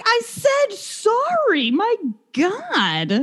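high, drawn-out whiny retort; mock exasperated protest. Kicked-back friend protesting the punch, exasperated. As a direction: stretch each word in indignation.

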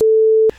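squeaky laugh bursting out; high burst of laughter. Real, not acted. Laugh after own line.

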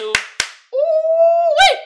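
high, loud hyped whoop; excited whoop. Real, not acted. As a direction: holler it out, drawn high. Hyped shout opening meme segment.

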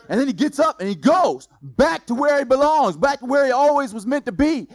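loud, rising triumphant preaching; preaching at full force. Acted. Preacher tells the congregation the king returns, triumphant. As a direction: proclaim the victory to the church.

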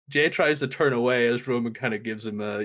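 ordinary talk

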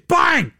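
high, loud shouted bang; hammed-up impact noise; mock bang. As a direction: yell it like a sudden kick. Sound effect kick.

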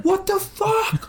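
very high, slow, laughing mock outrage; imitating angry kid. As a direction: stretch it out in a squeaky offended voice. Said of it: "he was like".

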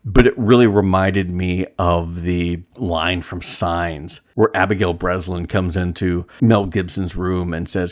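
usual speaking voice

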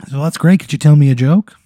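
high, loud, gushing small-talk voice; chatty reporter. Acted. Local reporter greets a former classmate, high gushy voice. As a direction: swoop up as an over-friendly interviewer. Acting the reporter interviewing the comedian.

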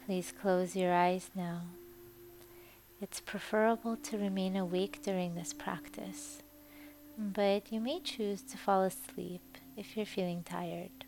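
guided meditation. Acted. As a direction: ease the listener gently toward sleep. Leads a Yoga Nidra meditation.

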